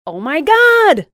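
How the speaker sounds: high, loud, drawn-out gasp of an idea; excited outburst. A narrator's reading. Louise interrupting Jessica, loud high thrilled cry. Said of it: "Louise interrupted".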